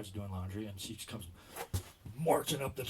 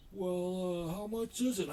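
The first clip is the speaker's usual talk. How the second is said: much higher, hesitant goofy question; dopey customer voice; 9 semitones higher, 4 dB louder, slower than usual. Retold or imitated. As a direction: ask it timidly like a clueless customer. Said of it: "He goes".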